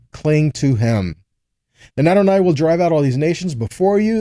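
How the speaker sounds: ordinary delivery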